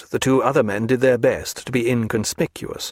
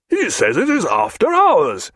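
higher, louder outraged cry; Jack indignant; 7 semitones higher, 4 dB louder than usual. A narrator's reading. Jack cries to Stephen, indignant. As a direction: appeal in outrage. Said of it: "he cried".

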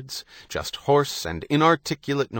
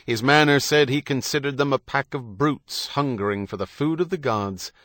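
ordinary delivery, nothing marked

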